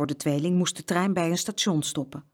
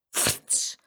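whooshing frits sound; 5 dB quieter than usual. A narrator's reading. Parakeets escape again.

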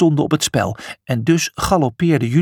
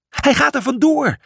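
high, mock shriek of alarm; a storybook shriek, pitched up; 11 semitones higher than usual. A narrator's reading. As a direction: cry it out, higher, in a hammed-up panic. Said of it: "gilde Julius".

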